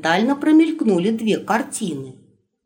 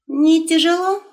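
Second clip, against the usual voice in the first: much higher, louder teasing question; teasing wolf; 9 semitones higher, 3 dB louder than usual. Acted. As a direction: call back over the shoulder, high. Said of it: "через плечо поинтересовался волк".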